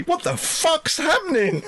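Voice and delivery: put-on high, slow shocked child with a laugh; shocked kid. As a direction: blurt it like a baffled kid, laughing. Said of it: "or would Randy say".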